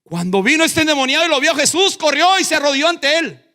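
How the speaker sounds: high, loud, driving preacher shout; full-force preaching. Real, not acted. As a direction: proclaim it at the top of your voice, fast and high.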